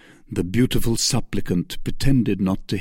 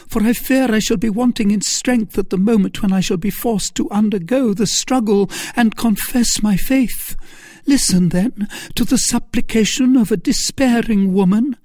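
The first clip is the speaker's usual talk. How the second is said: desperate pleading; 12 semitones higher, 4 dB louder than usual. Acted. Milady's desperate appeal.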